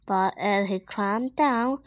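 plain reading voice

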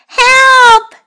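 high, loud cry for help; scared cry. Acted. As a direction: squeal in panic.

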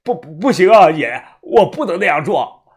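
loud, stammering, on the verge of crying; tearful refusal. Acted. Follower begged to kill his master, tearfully refusing. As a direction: refuse with tears in the voice. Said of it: "声音带着哭腔".

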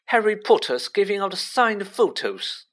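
high, loud jeering call; roared announcement. A narrator's reading. Malfoy to the whole crowd, roaring in mockery. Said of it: "Malfoy roared to the crowd".

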